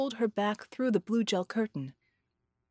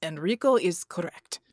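angry; 3 dB louder than usual. A narrator's reading. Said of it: "more angry than frantic".

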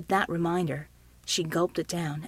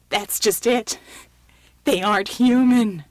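loud, horrified revelation; hysterical; 9 dB louder than usual. A narrator's reading. Shaken man revealing what he saw, hysterical. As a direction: blurt it out, overwrought.

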